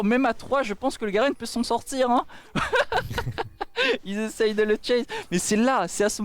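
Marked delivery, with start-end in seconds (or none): 2.3-4.0 high laugh. Real, not acted. Caster laughs at the play.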